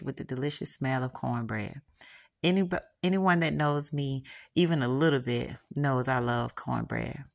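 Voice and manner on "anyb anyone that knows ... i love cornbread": plain reading voice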